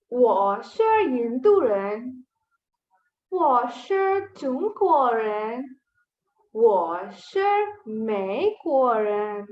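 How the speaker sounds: slow, deliberate model reading for learners; teacher modelling at half speed; slow careful reading. Shown as a demonstration. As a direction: read each syllable slowly and clearly. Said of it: "we will read this one last time".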